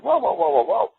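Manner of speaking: rising, high alarmed halting; mock-flustered judge crying whoa. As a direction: stop him short, voice climbing. Judge stops the reading abruptly.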